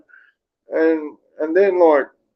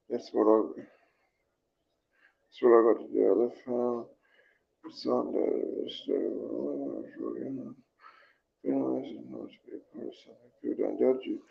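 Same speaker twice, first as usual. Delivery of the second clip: much softer, low-key reading to oneself; quiet, subdued read-out; reading comments aloud; 3 semitones lower, 14 dB quieter than usual. Real, not acted. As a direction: murmur through the comments on screen. Reads viewers' comments.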